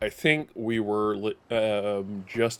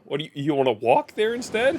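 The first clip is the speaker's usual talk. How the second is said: normal talking voice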